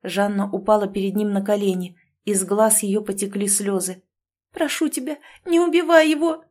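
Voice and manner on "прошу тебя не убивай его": pleading. A narrator's reading. Kneeling in tears, begging.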